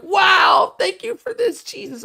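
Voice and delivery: very high-pitched, exaggerated wonder; mock awestruck gushing. Commenters thank livestream Jesus, hammed-up mock awe. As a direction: burst out high on the wow, then soften.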